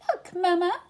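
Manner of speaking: put-on high, eager child's call; excited little Roo. A narrator's reading. Baby Roo calling to his mama, excited and high. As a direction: call out like a tiny excited joey.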